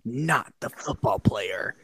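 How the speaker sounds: slow, drawn-out suspense sting; mock dramatic reveal. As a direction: deliver it like a soap-opera cliffhanger.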